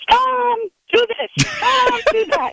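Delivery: high, slow nagging mom voice; imitating her mother. Caller mimicking her late mother bossing her father, high mock nag. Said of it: "my mother was always the one saying".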